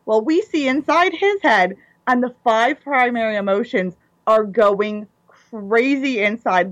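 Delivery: usual speaking voice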